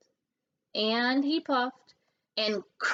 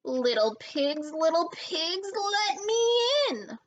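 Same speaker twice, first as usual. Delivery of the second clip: roar; 7 semitones higher than usual. A narrator's reading. Wolf at Boris's door, roaring. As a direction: build into a roar. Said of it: "roared the wolf".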